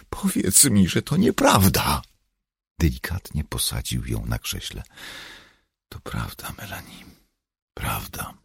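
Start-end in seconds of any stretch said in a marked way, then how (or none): 0.1-2.0 high, pleading cry; desperate, pitched-up appeal; distraught plea. A narrator's reading. Distraught Melanie pleads with him. 5.9-8.3 very soft, low near-whisper; hushed, low, consoling voice; gentle confirmation. A narrator's reading. Gently confirms bad news to her.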